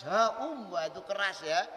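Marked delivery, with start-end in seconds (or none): 0.0-0.7 mock loud village call, higher and louder; loud reply. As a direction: answer back loud like a farmer calling across fields. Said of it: "Rasul jawab dengan suara yang keras".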